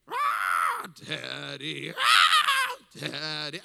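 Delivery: loud mock screams between chanted words; scream crying with chanting. Wife and sister at deathbed, imitated scream-crying and chanting. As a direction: alternate wailing screams and creepy chant. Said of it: "with my wife scream crying".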